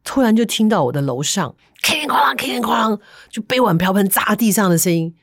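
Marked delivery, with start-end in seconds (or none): 1.8-3.0 whispered crash noise. Retold or imitated. Imitating crashing dishes upstairs.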